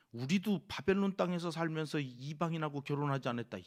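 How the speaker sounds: furious shout. Retold or imitated. Ezra imagined shouting at Jerusalem's people, furious yell. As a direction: yell it like a leader punishing sinners. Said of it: "막 소리를 지르고".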